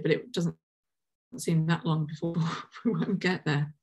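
real nervous-sounding laugh breaking into the words. Real, not acted. Host commenting on grim outlook for populations, laughing. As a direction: let a laugh slip out mid-sentence. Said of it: "I don't know why I'm laughing".

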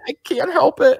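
high-pitched mock-helpless protest; imitated bawling. Husband bawling next to his wife, mock tearful. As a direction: play at being tearful, voice pushed high. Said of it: "I'm bawling next to my wife".

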